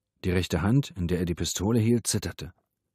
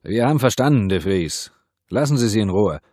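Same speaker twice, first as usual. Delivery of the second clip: loud, forceful appeal; raised, commanding call; urgent shouting; 3 semitones higher, 8 dB louder than usual. A narrator's reading. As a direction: shout to make him stop. Shouting to the hostage taker.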